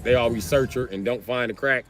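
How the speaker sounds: ordinary talk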